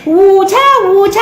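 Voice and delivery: "water" high and loud, long oo; mocking "water". Auntie mimicking the girl to her face, mocking "water". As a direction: throw the affected "water" back, like "wooter". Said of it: "said to that one girl".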